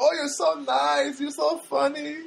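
high, gushing mock girl voice; put-on high-pitched admiring girl; young Armenian girl. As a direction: imitate a young woman's drawn-out compliments. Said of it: "there's this Armenian girl there".

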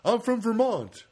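slow, high mock Vermont accent; made-up Vermont accent. Comedian posing as a Vermont native, uncertain put-on Vermont accent. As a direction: guess badly at a Vermont accent. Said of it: "I don't know how Vermont accent is".

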